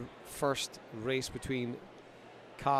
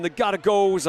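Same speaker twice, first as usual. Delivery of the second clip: American accent; 6 semitones higher, 9 dB louder than usual. Shown as a demonstration. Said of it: "I've got to say it in an American accent".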